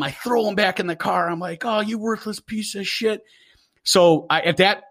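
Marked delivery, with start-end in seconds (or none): 1.6-3.2 retold angry scream. Humiliated handler screaming at his dog, retold as slow scolding. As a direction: drag out a half-voiced angry scream. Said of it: "I'm screaming at him".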